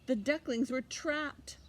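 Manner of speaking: usual speaking voice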